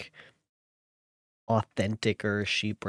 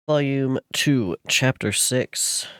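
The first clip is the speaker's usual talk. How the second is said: announcer voice; 3 semitones higher, 6 dB louder, slower than usual. Acted. Announcing chapter to audience.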